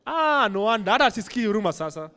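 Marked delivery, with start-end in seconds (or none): none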